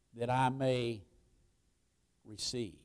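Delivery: soft, low, prayerful answer; hushed reply. Acted. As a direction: offer it quietly, like a private prayer. Models congregation's reply softly.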